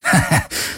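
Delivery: brief chuckle in character; a small, light acted laugh; short laugh. A narrator's reading. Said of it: "Sie lachte".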